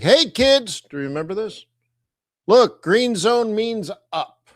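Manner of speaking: put-on high, loud call to the kids; mock yell. Trader retelling his call to webinar students, mock yell. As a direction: call out brightly pitched, punching the first and last words. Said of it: "that's where I yelled".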